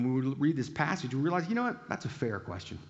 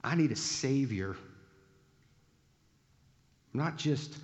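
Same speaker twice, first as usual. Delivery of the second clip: ordinary talk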